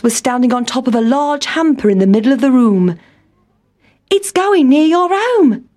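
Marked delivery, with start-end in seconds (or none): 4.1-5.6 high-pitched mouse exclamation; excited cry. A narrator's reading. Town Mouse atop the hamper, crying out excitedly. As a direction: cry out the good news in a high voice. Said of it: "cried Town Mouse".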